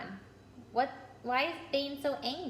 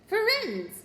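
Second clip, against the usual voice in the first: a loud, high, furious cry of a name; thundering; 11 semitones higher, 6 dB louder than usual. A narrator's reading. Bane the centaur thundering at Firenze. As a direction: roar the name across the clearing. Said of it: "Bane thundered".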